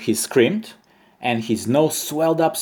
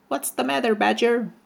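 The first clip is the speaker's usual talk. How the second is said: tiny, much higher voice for the ant; little ant; 12 semitones higher than usual. A narrator's reading. Ant asking the sad badger what's wrong, high and tiny. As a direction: squeak it out like a small bug. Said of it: "said the ant".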